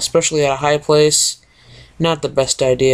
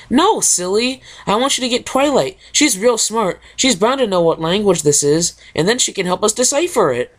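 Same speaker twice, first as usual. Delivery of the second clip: perky Pinkie; 6 semitones higher than usual. Acted. Pinkie voiced in story.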